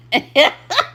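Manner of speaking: quick laugh breaking out; short real laugh. Real, not acted. Vlogger laughing again at the doll. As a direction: chuckle slipping out.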